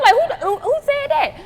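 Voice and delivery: high-pitched stammering demand; incredulous. Retold or imitated. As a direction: stumble over it in squealing disbelief. Acting out her shocked reply to rumour.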